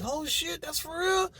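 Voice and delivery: high, soft mock astonishment; hushed falsetto disbelief, retold; acted amazement. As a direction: react wide-eyed, voice shooting way up. Retelling own amazed reaction.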